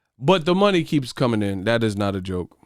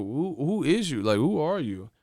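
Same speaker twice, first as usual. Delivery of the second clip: ordinary talk, nothing marked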